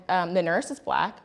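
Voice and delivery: normal talking voice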